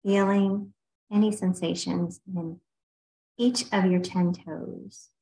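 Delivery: unhurried meditation guide with long pauses; guided meditation. Real, not acted. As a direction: ease listeners through a body scan, leaving space between phrases. Leads a body scan meditation.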